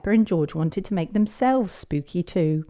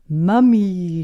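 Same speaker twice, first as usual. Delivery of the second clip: loud, drawn-out play call; shouted; 7 dB louder than usual. A narrator's reading. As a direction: call it out long as a small child. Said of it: "shouted George".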